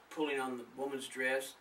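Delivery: usual speaking voice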